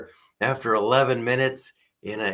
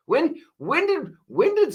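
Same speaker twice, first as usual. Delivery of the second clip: incredulous. Real, not acted.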